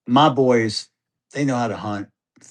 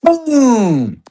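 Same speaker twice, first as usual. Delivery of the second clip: a loud, high boom of a shotgun blast; imitated gunshot; 14 semitones higher, 9 dB louder than usual. Dad retelling his son's first shot, loud imitated boom. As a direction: bark out the shot like the gun itself.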